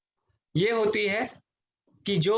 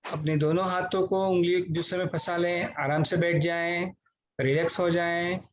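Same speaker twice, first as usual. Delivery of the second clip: guided meditation; 4 semitones lower than usual. Real, not acted. Teacher leading online class through deep breathing, guided meditation voice.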